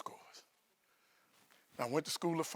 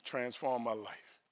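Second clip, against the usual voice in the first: ordinary talk, nothing marked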